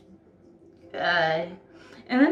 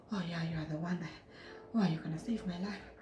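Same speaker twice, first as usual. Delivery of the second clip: hushed mock gratitude; 3 semitones lower, 11 dB quieter, faster than usual. Mocking thirsty gratitude.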